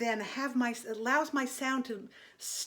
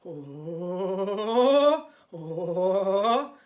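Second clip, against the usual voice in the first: bouncing ghostly oh in the throat, louder; ghost noises; 5 semitones lower, 5 dB louder than usual. Shown as a demonstration. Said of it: "making ghost noises".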